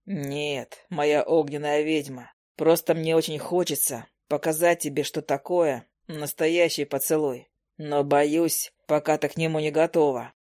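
low, slow male suitor; hunter hero, teasing. Acted. Hunter tempting his fiery witch with a kiss, low and teasing. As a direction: drop the voice and tease her unhurriedly.

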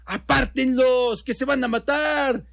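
high-pitched alarmed cry from the street; women crying out. Acted. Women outside to bystanders at a machete fight, alarmed cry. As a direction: call for help in a high voice. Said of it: "oían voces de mujeres que decían".

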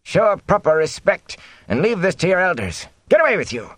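high-pitched, indignant telling-off; scolding elder. A narrator's reading. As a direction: send the boy off with a rebuke. Elder scolds a boy, shaking fist.

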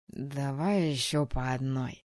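slow, slightly slurring tipsy voice; slurred speech. Acted. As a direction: slur the words a little, tipsy. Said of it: "немного заплетающимся языком".

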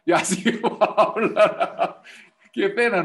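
high laughing embarrassed remark; laughing with embarrassment. Real, not acted. Laughing at awkward scene.

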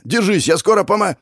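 high, hurried encouragement cut short; urgent shout. Acted. Hero rushing to save his partner, high urgent shout. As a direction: shout across the field, broken off.

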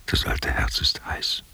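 slow, breathy whispered confession; a slow hushed confidence to her. Acted. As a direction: let it slip out in a slow whisper. Old man confiding to woman.

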